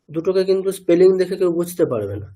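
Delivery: ordinary talk, nothing marked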